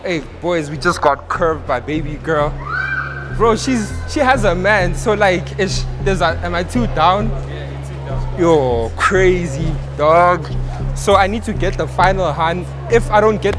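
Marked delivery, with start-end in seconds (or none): none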